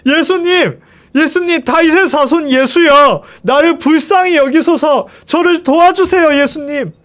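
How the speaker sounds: high, pleading shout for mercy; desperate cry. A narrator's reading. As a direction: cry out with all your strength. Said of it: "다시 한번 외쳤어요".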